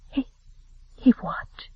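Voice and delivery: a breathless unvoiced whisper; stunned whisper. A narrator's reading. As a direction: whisper it in shock.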